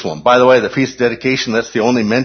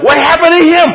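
much higher and louder, a cartoon gossip; mock high question; 9 dB louder than usual. Teacher mocks those who think believers fall away, squeaky gossip. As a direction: squeal it like a shocked busybody.

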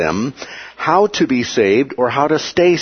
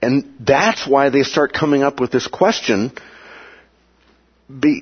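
ordinary delivery

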